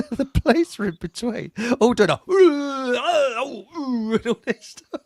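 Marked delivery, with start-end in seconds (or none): 1.8-5.0 laughing, drawn-out imitation of haka chanting; mock haka noises. Host imitating youths doing the haka, laughing mock chant. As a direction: mimic a haka through laughter.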